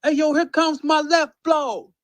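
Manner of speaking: high, loud battle-rap yell; battle rapper. Acted. Showing fans how battle rap talks, high shouted battle-rapper delivery. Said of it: "where we say shit like this".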